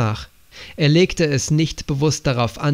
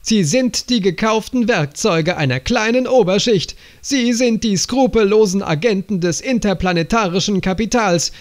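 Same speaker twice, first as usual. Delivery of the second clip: raised, high-pitched political tirade; ranting speech; 4 semitones higher than usual. Acted. Senator Morgan haranguing the voters in a broadcast, raised rant. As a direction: hammer every phrase at the crowd.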